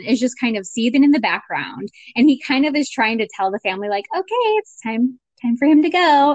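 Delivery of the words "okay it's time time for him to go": high-pitched mock politeness, straining to be nice; forced niceness. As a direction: act out seething behind a polite tone. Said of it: "he's trying to say it so nicely".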